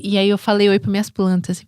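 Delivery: ordinary delivery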